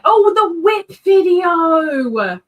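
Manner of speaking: loud, slow, drawn-out exclamation; a delighted shout of recognition; excited shout. Real, not acted.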